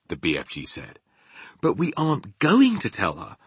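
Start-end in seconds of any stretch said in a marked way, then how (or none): 1.6-3.4 high, loud, eager correction; excited. Acted. Sophie explaining her new idea, excited. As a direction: reveal the plan eagerly. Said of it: "Sophie said excitedly".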